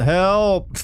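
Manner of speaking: high, stretched-out mock cry for help; yelled call for help. Player feeling cringy, yelling into the game for help. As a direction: shout "help" long and rising. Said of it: "I'm yelling into the game".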